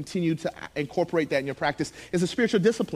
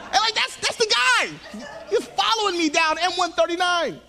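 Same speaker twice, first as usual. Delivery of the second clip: much higher comic alarm; mock panic; 11 semitones higher than usual. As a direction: play up the panic of being followed. Comic panic about the other driver.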